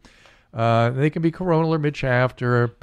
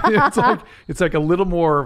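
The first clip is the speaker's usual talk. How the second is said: high burst of laughter into the words; laughing; 8 semitones higher, 4 dB louder than usual. Real, not acted. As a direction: crack up at your own joke. Laughs at own joke about Shannons.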